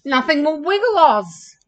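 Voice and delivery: hammed-up loud protest; bawled complaint. A narrator's reading. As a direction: bawl it like a frustrated camel. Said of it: "bawled Hannibal".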